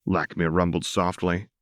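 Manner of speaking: plain reading voice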